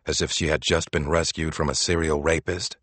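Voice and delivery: ordinary delivery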